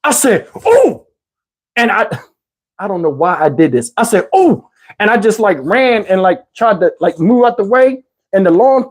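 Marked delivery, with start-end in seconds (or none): 0.7-1.0 high, loud falsetto "ooh" of panic; startled squeal. Retold or imitated. Boy spotting his crush at the door, high panicked squeal. 4.3-4.7 startled squeal. Retold or imitated. Boy spotting his crush at the door, high panicked squeal. Repeated squeal, running away.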